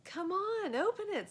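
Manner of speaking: high, bright impatient push; eager urging. Acted. Fred eagerly urges Joe to open it. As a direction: hurry your friend up.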